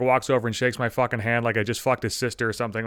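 ordinary talk, nothing marked

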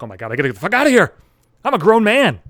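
acted-out loud, rushed urge to escape; panicked self-talk. Acts out his urge to flee.

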